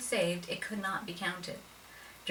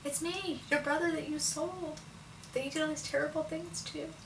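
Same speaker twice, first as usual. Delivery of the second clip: much higher, tearful voice; tearful confession; 10 semitones higher than usual. Acted. Joseph revealing himself to his brothers, high and tearful. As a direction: break open as you reveal yourself. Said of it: "He breaks down crying".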